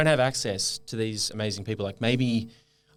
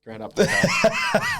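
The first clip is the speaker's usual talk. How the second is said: laughing; 6 dB louder, much slower than usual. Real, not acted. Laughing title suggestion.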